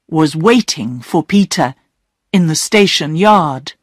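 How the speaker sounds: ordinary delivery, nothing marked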